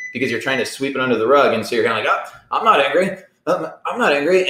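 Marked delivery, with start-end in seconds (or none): 2.1-4.5 mock denial. Mock denial of being angry.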